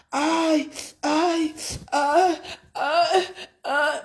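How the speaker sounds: hammed-up whimpering aïe; mocking a woman's cries; mock moans. Imitating sex cries.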